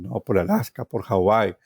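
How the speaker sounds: ordinary talk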